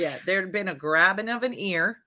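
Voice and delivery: usual speaking voice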